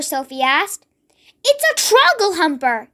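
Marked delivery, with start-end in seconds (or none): 1.4-2.9 mild shout. A narrator's reading. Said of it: "he shouted".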